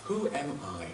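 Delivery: soft, inward self-questioning; quietly. Acted. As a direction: ask it quietly, as if to yourself. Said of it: "Ask yourself quietly".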